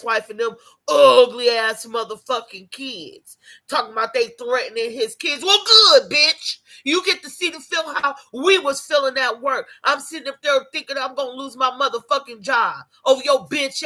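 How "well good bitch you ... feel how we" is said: "Well, good" shot out loud and high; spiteful burst of satisfaction; loud mocking gloat at him; gloating shout. Real, not acted. Gloating at crying official.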